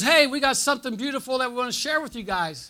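imitating an eager pastor; eager announcement. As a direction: announce it brightly to the whole room. Pastor announces something to crowd.

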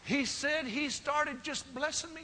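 high, loud revival preaching, building up; full-force preaching. Acted.

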